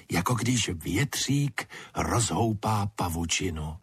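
usual speaking voice

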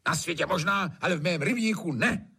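high, irritated master's comeback; curt retort. A narrator's reading. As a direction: snap back at the servant. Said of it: "odsekl Trautenberg".

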